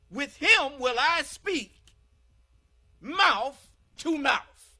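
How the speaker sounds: "mouth to mouth" stretched out and proclaimed; slow booming proclamation. Acted. Preacher proclaiming God's promise to the congregation, slow and booming. Climax of scripture reading.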